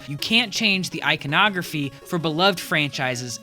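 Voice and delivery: usual speaking voice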